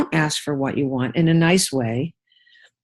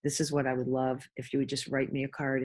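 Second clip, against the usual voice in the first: much softer, lower, sweet asking; gentle request; 4 semitones lower, 13 dB quieter, faster than usual. Retold or imitated. Wife gently asks husband for a card, much softer. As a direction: ask softly for what you want. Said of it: "I actually changed my tune".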